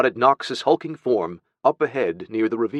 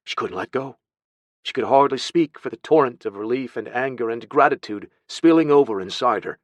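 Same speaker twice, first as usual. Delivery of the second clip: ordinary delivery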